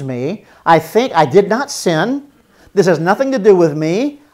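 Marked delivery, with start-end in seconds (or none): none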